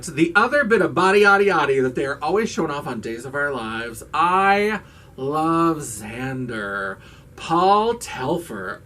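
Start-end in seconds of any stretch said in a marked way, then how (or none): 4.1-8.8 drawn out, slow reveal of her top pick; dramatic reveal. Real, not acted. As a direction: stretch each word like a countdown winner. Number one spot reveal.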